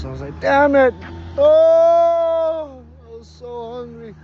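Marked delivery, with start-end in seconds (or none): 0.4-2.8 high, loud mock wail of dismay; anguished outcry. Anguish at losing his breakfast. 3.1-4.2 high, soft mock whimper; plaintive whine. Plaintive after losing breakfast.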